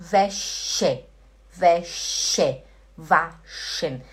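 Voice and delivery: "Wäsche, waschen" with a strong sh in the middle; hushed sh sound. Shown as a demonstration. Teacher modelling SCH, "Wäsche, waschen" with clear sh. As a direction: split "Wäsche" and "waschen" around a full sh. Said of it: "tem que chiar".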